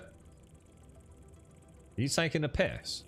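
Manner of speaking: ordinary delivery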